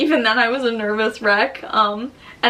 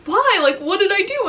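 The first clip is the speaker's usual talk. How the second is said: puzzled husband; 10 semitones higher, 4 dB louder than usual. Retold or imitated. Imitating husband's puzzled reply.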